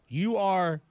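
ordinary talk

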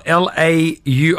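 slow letter-by-letter spelling. Shown as a demonstration. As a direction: spell each letter out slowly. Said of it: "I'm spelling you".

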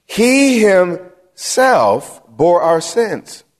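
"He himself" stretched slowly before "bore our sins"; slow, separated beats on "He himself"; drawn-out emphasis. Real, not acted. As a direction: dwell on "He himself" word by word. Reads verse stressing who did it.

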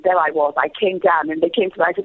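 ordinary talk

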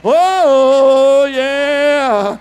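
loud preacher's cry; a sudden loud "Oh"; shouted oh. Real, not acted. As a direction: cry it out to the church. Preacher exclaims at congregation.

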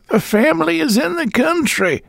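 put-on high, thin old landlady voice; a deaf old woman fobbing him off, high-pitched. A narrator's reading. Landlady brushing off the visitor at the door, high old-woman voice.

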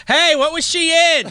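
high-pitched playful yell; yelling across theater. Pretend. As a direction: call out as if across a big theater. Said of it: "I'll just yell across the movie theater".

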